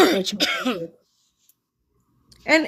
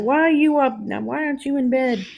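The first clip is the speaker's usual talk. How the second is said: higher-pitched mock scolding with a Southern accent; Tracy's Southern accent; 5 semitones higher than usual. Imitating an absent friend who'll get mad at bedtime, Southern accent. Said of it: "get mad with that Southern accent".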